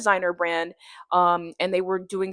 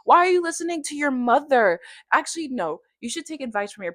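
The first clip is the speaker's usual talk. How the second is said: scolding herself; 6 semitones higher than usual. Retold or imitated. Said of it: "But I'm like".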